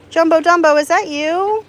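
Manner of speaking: high, loud singsong call to an animal; calling a pet. Real, not acted. Owner calling to her duck Jumbo Dumbo, high pet call. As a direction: call out sweetly, pitched right up.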